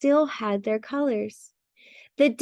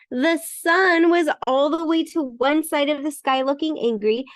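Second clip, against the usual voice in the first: plain reading voice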